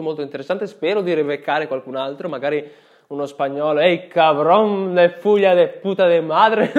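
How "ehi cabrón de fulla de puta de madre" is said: put-on Spanish accent. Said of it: "Ho imparato ste cose qua da Narcos".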